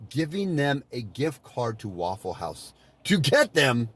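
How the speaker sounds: usual speaking voice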